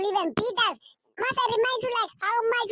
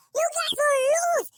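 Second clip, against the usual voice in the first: taunting shout; 5 semitones higher, 4 dB louder than usual. Real, not acted. Taunting rival fans.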